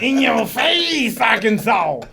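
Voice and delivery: big slow holler to the crowd; hyped catchphrase shout. Real, not acted. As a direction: stretch it out like a show slogan. Hyped echo of show catchphrase.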